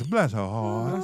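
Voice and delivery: put-on slow, low Southern accent with a laugh in it. Mocking a clumsy driver on video in a slow Southern accent. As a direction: drawl it out like a Southern lady pitying someone. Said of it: "in my southern voice".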